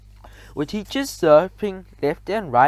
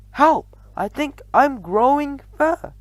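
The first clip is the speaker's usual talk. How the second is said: put-on boy screaming; 10 semitones higher than usual. A narrator's reading. Boy growing fur, screaming in panic. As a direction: scream for help.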